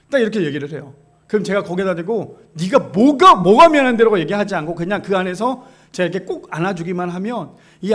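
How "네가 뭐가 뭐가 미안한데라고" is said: louder, sharp demand from a father; a father snapping back at his sulking daughter, loud; mock angry retort. 딸에게 버럭하는 아빠.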